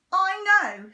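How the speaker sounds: loud, high snap; Henry snapping at Dad; snapped. Acted. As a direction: bite it off. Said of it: "snapped Henry".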